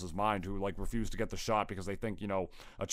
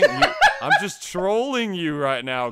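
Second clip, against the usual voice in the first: laughing; 7 semitones higher, 9 dB louder, slower than usual. Real, not acted.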